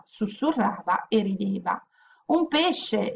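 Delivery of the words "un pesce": pitched high, thrilled and amazed; excited exclamation. Acted. Zaccarina sees a fish in the leaf, excited exclamation. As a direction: cry out in delight at the fish. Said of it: "esclamò Zaccarina".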